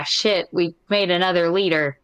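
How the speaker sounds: ordinary talk, nothing marked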